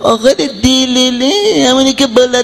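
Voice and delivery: high, slow sing-song whine; pleading customer voice. Retold or imitated. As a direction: draw out the dalaleh refrain like a desperate simpleton. Assistant repeats customer's riddle.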